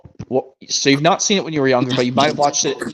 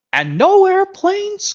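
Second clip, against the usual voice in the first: much higher, louder, very slow groan; 3 dB louder, much slower than usual. A narrator's reading. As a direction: wail it high and long like a sad engine. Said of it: "groaned Hersey".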